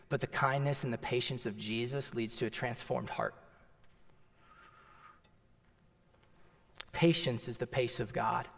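low, soft reflective sermon voice; quiet reflection. Acted. Pastor reflecting with the congregation, low and quiet. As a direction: settle down and speak it softly.

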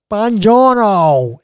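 slow, exaggerated American take on Italian; bad American Italian accent. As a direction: say it like a clueless American in Italy. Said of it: "in the worst American Italian accent".

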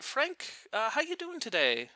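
high-pitched imitated casual greeting; lightly voiced grandmother. Injured grandmother phones dad hiding her pain, imitated high, casual hello. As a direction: play a grandmother pretending all is fine.

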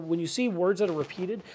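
ordinary delivery

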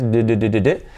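"d" repeated with vocal cords buzzing; voiced D. Shown as a demonstration. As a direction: pulse "d, d, d" as separate voiced taps. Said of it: "activamos las cuerdas vocales". ("d" sonora, repetida con las cuerdas vocales)

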